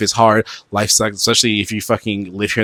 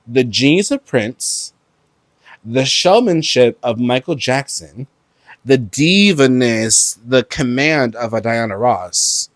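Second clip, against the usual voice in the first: slow, deliberate, each phrase weighted; slow emphatic rerun; slower than usual. Shown as a demonstration. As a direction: lay it out slowly like a hype verse. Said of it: "Let's run that back".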